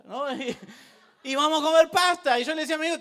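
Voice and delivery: ordinary delivery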